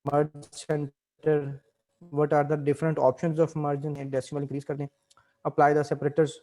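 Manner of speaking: ordinary talk, nothing marked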